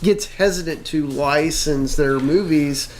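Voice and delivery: ordinary delivery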